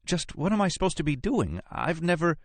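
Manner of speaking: very high-pitched panicky protest; panicked. Acted. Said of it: "Nora asked in a panic".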